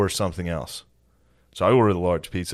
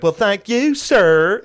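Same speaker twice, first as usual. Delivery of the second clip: high polite Southern drawl; Southern accent; 13 semitones higher, 8 dB louder, much slower than usual. Retold or imitated. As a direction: thank him sweetly in a high Southern accent. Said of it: "his southern accent".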